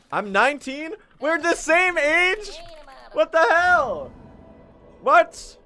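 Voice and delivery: a squeal of genuine astonishment; shocked disbelief. Real, not acted. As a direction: streamer finds out a chatter is his age, shrieking. Learns the chatter is his age.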